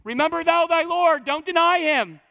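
high, loud cry across to his wife; retold yell. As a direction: call it out to her as she is led away. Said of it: "he yells out".

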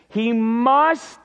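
MUST stretched out and stressed hard; a long, weighty "must"; great emphasis on MUST. Shown as a demonstration. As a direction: hold and press the word MUST. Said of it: "there's great emphasis".

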